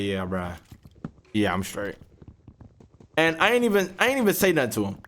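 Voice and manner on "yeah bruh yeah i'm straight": low, soft fake-fine reply; cold sarcasm. Answering the roommate with quiet cold sarcasm. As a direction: pretend it's fine, coldly. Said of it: "I was like".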